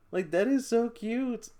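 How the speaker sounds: high, slow, loud gush over cuteness. Real, not acted. Vlogger gushing over a baby photo, high squeal.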